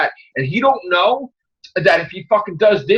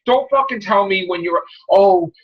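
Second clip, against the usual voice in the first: ordinary talk, nothing marked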